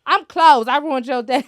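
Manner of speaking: loud, high mocking announcement; mock a cashier proudly turning people away; rude, proud cashier. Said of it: "you have to say it like that".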